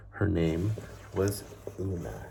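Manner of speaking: even, unhurried reading-aloud voice; storybook narration. Acted. Teacher reading a picture book to children, story narration. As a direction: tell the tale like a bedtime story.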